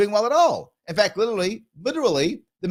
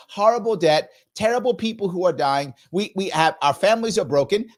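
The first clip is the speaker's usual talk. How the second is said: usual speaking voice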